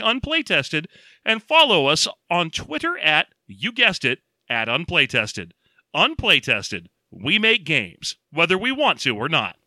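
ad read. Acted. Reads a podcast ad.

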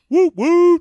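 loud, high-pitched double toot; woot horn imitation. Demonstrating what the horn does, high loud toots. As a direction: hoot twice like a horn. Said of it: "You just hit it and goes".

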